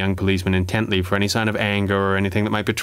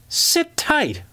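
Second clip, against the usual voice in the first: incredulous. Acted. Constable repeating a captor's order, incredulous. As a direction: pitch shoots way up in disbelief. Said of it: "Parker was incredulous".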